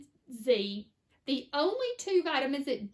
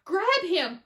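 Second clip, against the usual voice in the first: loud, higher urgent command; order; 6 semitones higher, 7 dB louder than usual. A narrator's reading. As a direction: snap the order to act fast. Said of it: "ordered Mister Wonka".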